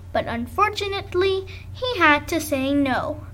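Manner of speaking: plain reading voice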